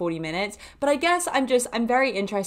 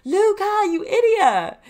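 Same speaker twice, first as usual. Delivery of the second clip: high, loud yell with stretched words; retold shout; 12 semitones higher, 6 dB louder, slower than usual. Calling to her skater friend from the car, high loud shout. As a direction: holler it as if out of a car window. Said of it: "and I shout, I'm like".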